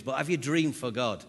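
ordinary talk, nothing marked

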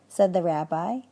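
usual speaking voice

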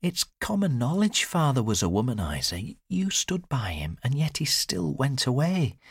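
high-pitched daughter's voice; high, insistent reassurance; daughter consoling her mother. A narrator's reading.

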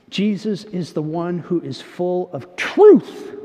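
ordinary delivery

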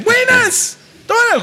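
youth radio DJ greeting. Retold or imitated. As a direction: throw out a big radio-announcer hello. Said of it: "Parece los maes de todas las radios juveniles". (imitando a un locutor de radio juvenil, muy agudo)

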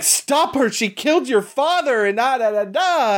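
high-pitched mock-desperate cry; hammed-up high urgent begging; mock frantic plea. Retold frantic plea from Carol.

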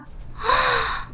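sharp, high gasp of shock; astonished gasp. A narrator's reading. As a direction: gasp at a startling sight. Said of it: "ذهله ما رأى". (شهقة ذهول)

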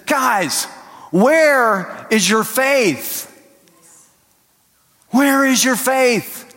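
high, slow, drawn-out disbelief; exasperated rebuke. Retold or imitated. Jesus scolding the disciples after the storm, exasperated. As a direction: stretch each word like a let-down teacher.

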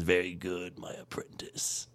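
hushed menacing Palpatine whisper; breathy villain whisper; Emperor voice. Retold or imitated. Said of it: "He says".

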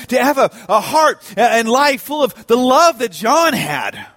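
impassioned preaching. Real, not acted. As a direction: preach it with mounting force. Preaches with force.

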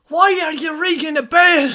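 put-on high laughing girl voice; girl's voice. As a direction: squeal it like an annoyed girl. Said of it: "another girl going".

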